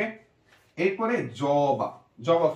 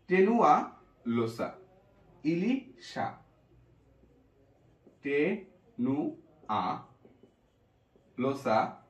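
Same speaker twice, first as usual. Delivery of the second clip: slow dictation; much slower than usual. Shown as a demonstration. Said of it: "dictating the name slowly".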